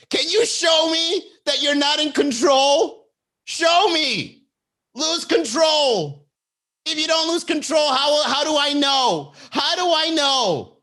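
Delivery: slow, high, loud imitated demand; hammed-up drawn-out yelling; mock goading shout. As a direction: plead loudly for them to crack, stretching the words. Voices her demanding he lose control.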